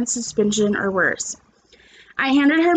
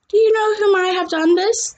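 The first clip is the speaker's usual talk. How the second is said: higher, concerned nurse voice; nurse asking; 8 semitones higher than usual. A narrator's reading. Nurse questioning the boys, concerned. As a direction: ask like a worried school nurse. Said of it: "the nurse asked".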